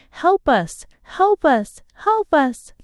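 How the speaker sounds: high-pitched, restrained cry for help; mild narrated scream. A narrator's reading. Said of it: "and they scream".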